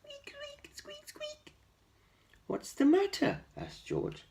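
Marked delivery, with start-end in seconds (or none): 0.0-1.5 tiny, very high whispered squeaks; mouse squeaks. A narrator's reading. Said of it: "They were all squeaking". 2.5-3.4 much higher, louder caring question; kind giant asking. A narrator's reading. George asks the mouse.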